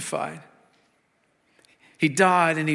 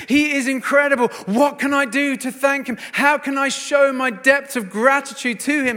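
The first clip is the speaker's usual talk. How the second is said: high-pitched, loud rush of gratitude; overjoyed amazement; 7 semitones higher, 5 dB louder than usual. Retold or imitated. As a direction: gush in wonder and thankfulness. Mary marvelling, acted out.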